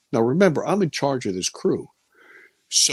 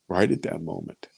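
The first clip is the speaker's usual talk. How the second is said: hushed repeat; 5 semitones lower, 5 dB quieter than usual. Real, not acted. Recalling the moment of fear, hushed and lower. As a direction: lower and softer, sinking into the memory.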